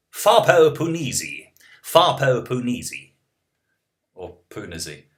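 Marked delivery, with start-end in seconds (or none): 0.1-3.1 high, slow, exaggerated British accent. Shown as a demonstration. Streamer pronouncing a viewer's name on request, hammed-up British accent. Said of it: "pronounce my name in your British accent".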